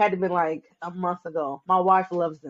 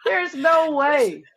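excited shout; 8 semitones higher, slower than usual. Real, not acted. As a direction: shriek it in delighted surprise. Shocked by the matching snack.

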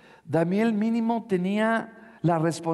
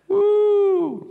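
sudden joyful shout of uh; high loud whoop; 10 dB louder than usual. Real, not acted. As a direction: let out a high whoop of joy. Exclamation after miracle.

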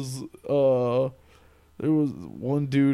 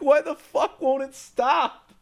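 exasperated; 10 semitones higher, slower than usual. Retold or imitated. Quoting himself, exasperated, high pitched.

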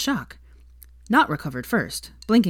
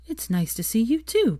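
coolly; 6 semitones higher than usual. A narrator's reading. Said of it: "she said coolly".

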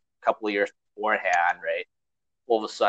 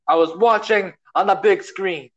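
high, loud promo ending in a wild woo; Ric Flair impression; 10 semitones higher, 4 dB louder than usual. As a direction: strut it out like the Nature Boy. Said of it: "you can't forget about Ric Flair".

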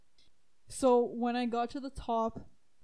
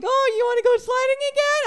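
much higher, louder, fast shout; eager friend; 12 semitones higher, 9 dB louder, much faster than usual. Retold or imitated. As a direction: bounce with eagerness to go again.

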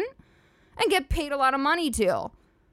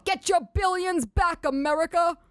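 put-on old man ad voice; 7 semitones higher than usual. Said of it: "And he would go".

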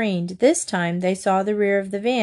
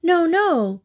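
protesting; 9 semitones higher than usual. A narrator's reading. Child protests to mother.

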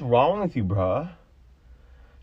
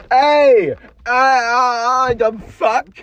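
sudden loud cry, higher and louder; startled loud yell; 11 semitones higher, 10 dB louder, much slower than usual. Real, not acted. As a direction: yelp at the blow. Kicked again in the car.

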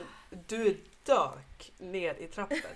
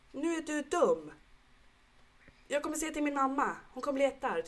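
put-on high, childish scolding voice; higher little girl voice, indignant; angry little girl; 8 semitones higher than usual. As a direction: play the cross six-year-old threatening to tell mum. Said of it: "hon blev ju arg på Adrian".

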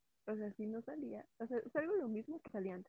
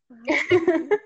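ordinary delivery

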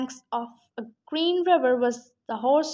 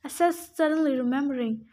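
usual speaking voice

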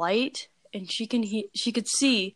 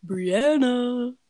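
creepy doll call, higher and louder; Fido's voice; 4 semitones higher, 6 dB louder than usual. Acted. Clown doll calls from the parents' room, creepy put-on voice. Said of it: "that's the voice that she used to give Fido".

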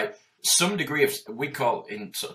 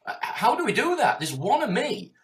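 much higher, incredulous pleading; disbelieving outburst, pitched way up; exasperated disbelief; 10 semitones higher, 3 dB louder than usual. Retold or imitated. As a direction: throw up your hands at an impossible demand. Exasperated retort to imagined kids.

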